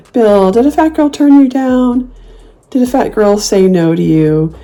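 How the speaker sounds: slow, high, fake pity; mock sympathy. Taunting Bill online, slow mock pity. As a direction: coo it, pretending to feel sorry. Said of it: "I like to say".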